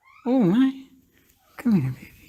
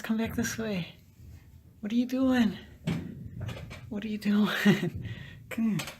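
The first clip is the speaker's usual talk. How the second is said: coaxing pet talk; 6 semitones higher than usual. Real, not acted. Coaxing the cat down.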